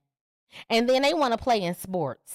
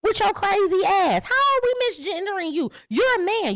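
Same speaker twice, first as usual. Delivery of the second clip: outraged retort; 8 semitones higher than usual. Real, not acted. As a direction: shout it in disbelief.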